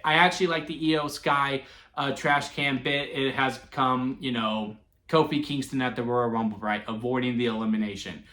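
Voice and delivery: usual speaking voice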